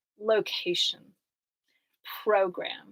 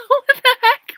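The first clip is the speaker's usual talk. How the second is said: high, loud laughing squeal through the words; laughing disbelief; 11 dB louder than usual. Real, not acted. Laughing at her own courtroom sketch, squealing. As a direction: crack up mid-question.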